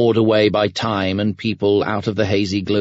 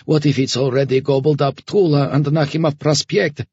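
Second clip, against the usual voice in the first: higher-pitched, urgent inner question; Homer's anxious thought; 7 semitones higher than usual. A narrator's reading. Homer wondering to himself about the stations, higher and urgent. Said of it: "thought Homer".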